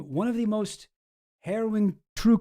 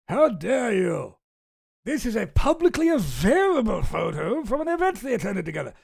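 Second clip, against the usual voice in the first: hammed-up affronted put-on voice; mock indignation; 3 semitones higher than usual. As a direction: pretend to be deeply scandalized, stretching the words. Mock reply, set against usual repeat.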